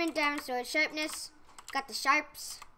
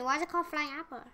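normal talking voice

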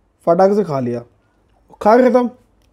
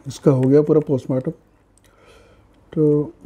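chewing while talking; speaking between bites; mouth full. Real, not acted. Seen 2: Food put in mouth at -2.0; talks with a busy mouth afterwards.

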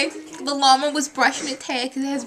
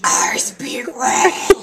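slow breathy whisper; much slower than usual. Acted. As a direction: whisper it very slowly. Elephant whispers a line.

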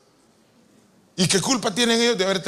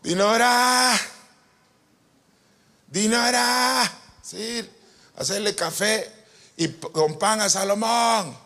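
a higher voice calling the daughter, drawn out; a higher mother-in-law voice, stretching out Dinora; 6 semitones higher, slower than usual. Retold or imitated. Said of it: "decía mi suegra".

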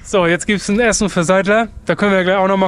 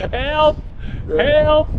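high playful help call; mock cry for help; 9 semitones higher than usual. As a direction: call for help half-laughing. Jokingly crying for help.

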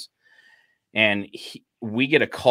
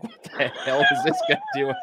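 much higher, cracking up through the words; laughing. Retold or imitated. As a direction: laugh helplessly while asking. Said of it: "I just laugh my ass off".

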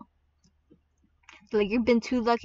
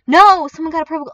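high, very loud cry; alarmed shout; 5 semitones higher, 12 dB louder, faster than usual. Real, not acted. Player seeing another's purple glow, alarmed shout. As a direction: shout it in sudden dismay.

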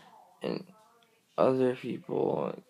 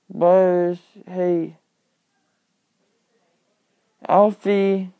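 calling the cat; 6 semitones higher, 9 dB louder, slower than usual. Real, not acted.

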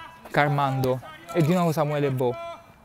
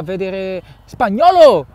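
loud, slow drawn-out call; excited shout; 7 dB louder, slower than usual. Real, not acted. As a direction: stretch the name as he shoots. Shot on goal, voice rises. (grido eccitato)

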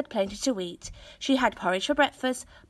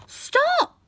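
much higher, louder panicked cry; greedy boy crying at the pot to stop; 5 dB louder than usual. A narrator's reading. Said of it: "cried the boy".

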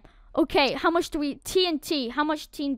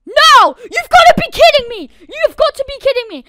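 high, loud outraged yell; furious shout; 10 semitones higher, 15 dB louder than usual. Real, not acted. Gamer whose bed just got destroyed, shouting in outrage. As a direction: explode in disbelief.

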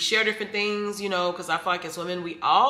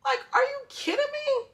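high-pitched, drawn-out disbelief; re-enacted squeaky "what?" of amazement; amazed reaction. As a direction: shoot the pitch way up in astonishment. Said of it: "I just was like".

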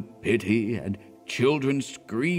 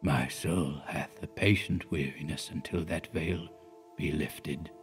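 put-on low, much softer, weary old man; low faint whisper of a dying clergyman; faint accents; 6 semitones lower, 9 dB quieter than usual. A narrator's reading. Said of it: "said he in faint accents".

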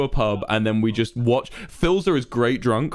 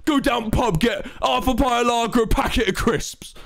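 angry English bloke; 11 semitones higher than usual. Retold or imitated. S0 showing his bald English bloke voice, hammed-up accent. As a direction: bark it like a cranky English pub regular. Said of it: "you do the voice I do when I'm trying to do, like, a bald, fat English bloke".

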